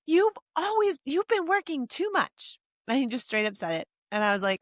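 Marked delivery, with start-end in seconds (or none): 0.1-2.6 put-on high child voice, slow and complaining; gently imitating her son's complaint; young son. Mom voicing her young son.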